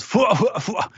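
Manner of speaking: high, puffing "fwa" laugh; imitated helpless cartoon laugh; high fake laugh. Host imitates Killer under the fruit's effect, high puffing laugh. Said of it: "under the effect of the smile fruit, goes".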